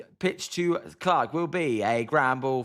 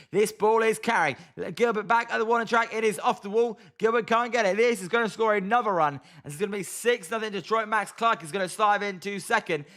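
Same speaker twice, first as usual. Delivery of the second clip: excited play-by-play; 7 semitones higher than usual. Acted. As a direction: call the hit like a fan-announcer losing it. Excited home-run play-by-play call.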